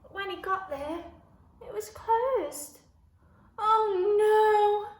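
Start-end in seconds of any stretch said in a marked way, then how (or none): 3.6-5.0 high, very loud "oh no"; dismayed cry. A narrator's reading. George finding the shop closed, loud dismayed cry. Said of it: "cried George".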